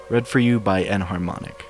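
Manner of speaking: ordinary delivery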